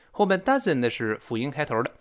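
normal talking voice

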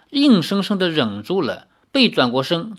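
usual speaking voice

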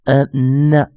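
"嗯, 呢" as a hummed N released into schwa; stressed dark N. Shown as a demonstration. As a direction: build the N from "嗯" into "呢". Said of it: "重读N的念法".

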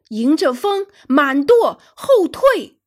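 loud, high shouted orders, building; captain's roar. A narrator's reading. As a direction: shout orders to the crew, rising to the last. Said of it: "法拉格特舰长大声吼道". (大声吼出命令，越喊越高)

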